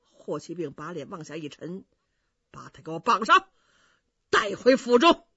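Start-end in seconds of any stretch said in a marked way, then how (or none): 2.5-5.3 stern order. Acted. 大将军下令.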